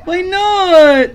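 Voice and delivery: put-on whiny kid voice. Whiny character voice in game.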